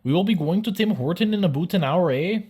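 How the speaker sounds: high, loud mock-Canadian question. Shown as a demonstration. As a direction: ask it as an exaggerated Canadian. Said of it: "all Canadian sentences being phrased as questions".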